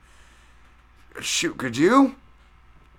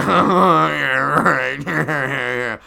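loud, high mock group laughter through the words; retold laughing reaction; 5 semitones higher, 9 dB louder, much slower than usual. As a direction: laugh it off like amused buddies. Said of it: "And we're like".

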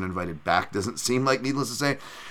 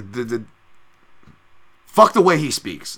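normal talking voice

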